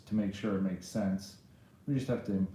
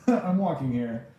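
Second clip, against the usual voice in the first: louder mock New Yorker; New York accent; 3 semitones higher, 5 dB louder than usual. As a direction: call it out like a classic New Yorker. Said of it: "You know how they say in New York".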